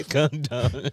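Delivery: calm down through laughter; laughing. Real, not acted. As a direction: giggle while telling her to calm.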